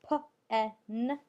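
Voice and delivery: P-N-P-E-N starting as separate sounds, puh, eh, nn; P-N-P-E-N broken up with gaps; sounded out. Shown as a demonstration. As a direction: sound out P-N-P-E-N loudly and piece by piece. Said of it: "tap out the first syllable".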